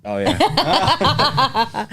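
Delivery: high laughing admission. Real, not acted. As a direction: laugh it out sheepishly. Caught out, laughs.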